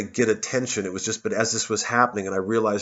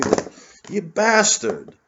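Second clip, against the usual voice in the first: sudden drawn-out curse, higher and louder; angry outburst; 10 semitones higher, 4 dB louder, much slower than usual. Real, not acted. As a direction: snap at the bottle in real annoyance. Cursing the wobbly brandy bottle.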